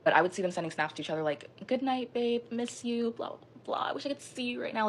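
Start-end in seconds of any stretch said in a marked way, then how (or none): 1.7-4.9 high-pitched mock sweet-nothings; mocking lovey-dovey. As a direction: mimic their sappy texts in a high voice. Mimics lovey texts between cheaters.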